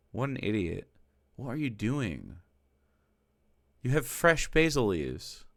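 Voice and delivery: usual speaking voice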